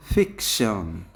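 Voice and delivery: Fiction with ফিক hit loud; teacher modelling fiction, strong first beat; stress on first syllable. Shown as a demonstration. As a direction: punch the opening ফিক of fiction. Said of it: "ফিকের পরে আমরা".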